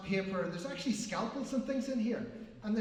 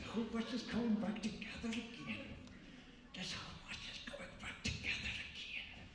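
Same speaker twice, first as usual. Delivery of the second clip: softer, intent hushed voice; hushed incantation; 2 semitones higher, 9 dB quieter than usual. Pretend. Magician willing the broken watch back together, hushed incantation. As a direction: fade from soft voice into a breathy whisper.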